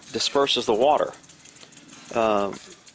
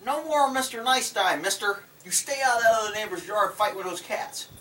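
mock stern pet talk; 8 semitones higher, 5 dB quieter than usual. Real, not acted. Mock-stern warning to cat.

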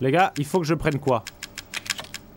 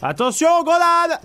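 loud, high alarmed shout; grenade warning yell; 8 dB louder than usual. Real, not acted.

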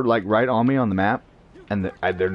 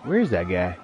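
normal talking voice

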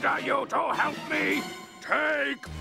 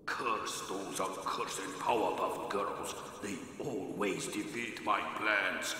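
much softer and lower, plotting under his breath; scheming quietly; 12 semitones lower, 8 dB quieter than usual. Acted. Villain alone in his lair, plotting in a low hushed voice. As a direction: mutter the evil plan to yourself, low and hushed.

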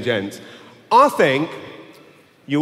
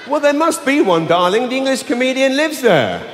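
wife voice; 8 semitones higher than usual. Retold or imitated. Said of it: "wife voice, high and raised".

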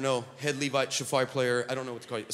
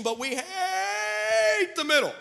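HATE stretched long, high and shouted; drawn-out stress on hate; 12 semitones higher, much slower than usual. Real, not acted. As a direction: draw out "hate" into a rising shout. Stressing dislike of middles.

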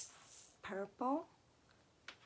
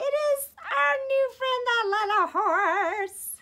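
high puppet voice; 13 semitones higher, 6 dB louder, slower than usual. Acted.

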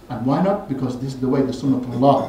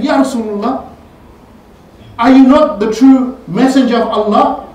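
raised, higher voice, demanding an answer; indignant protest; 8 semitones higher, 8 dB louder than usual. Retold or imitated. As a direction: challenge him loudly like a wronged man. Omar protests to the Prophet.

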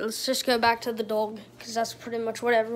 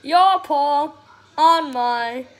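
dog voice; 7 dB louder, much slower than usual. Pretend. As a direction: speak for the dog, indignant. Voicing dog at treats.

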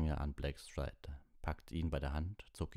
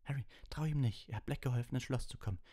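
high, urgent warning; crying out; 9 semitones higher, faster than usual. A narrator's reading. Hermine warning Harry about Lupin, crying out urgently. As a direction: warn him frantically, pitched high. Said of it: "schrie Hermine".

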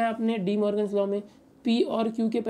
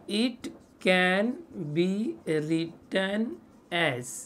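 slow words with gaps between; dictating; much slower than usual. Real, not acted. Teacher gives students the answer's wording, dictating slowly. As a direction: dictate it piece by piece.

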